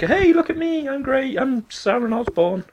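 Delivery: high, mocking, attention-seeking showoff; Sharon Osbourne. Teller mocks a useless judge, Sharon Osbourne. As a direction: send up a ditzy judge in falsetto.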